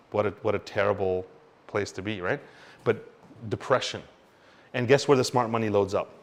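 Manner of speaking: normal talking voice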